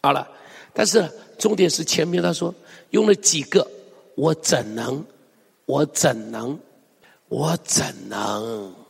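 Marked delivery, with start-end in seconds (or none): 4.1-8.7 low, slowing, grieving repetition; sighing lament. Acted. Preacher reads God's anguished question, lamenting. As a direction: sigh it out, drawn longer on the last. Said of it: "这不就是何西阿的叹息吗".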